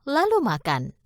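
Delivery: ordinary delivery, nothing marked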